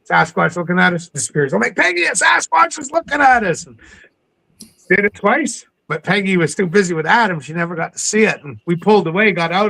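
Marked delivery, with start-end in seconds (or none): 1.8-3.7 urgent alert. Retold or imitated. Urgently alerting Peggy on boat.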